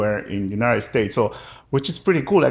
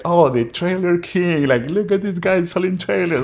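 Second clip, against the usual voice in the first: slow, higher teasing sneer at a coworker; mocking tease; 6 semitones higher than usual. Office guys teasing [name] about selling trailers, mocking slow jeer. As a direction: rib him like office buddies making fun. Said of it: "they made fun of me".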